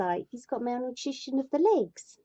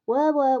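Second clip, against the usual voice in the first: mock spinning wheel; 5 semitones higher, 7 dB louder than usual. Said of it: "spinning wheel noise again".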